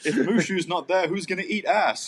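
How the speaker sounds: high, loud comic indignation; mock outrage. Host protesting Mushu's absence, mock outraged. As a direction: demand it in hammed-up dismay.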